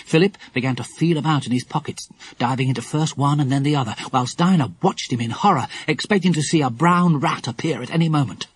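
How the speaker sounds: ordinary delivery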